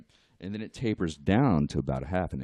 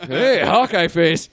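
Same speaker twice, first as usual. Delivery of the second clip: mock greeting; 9 semitones higher, 13 dB louder, slower than usual. Mock customer greeting, slow.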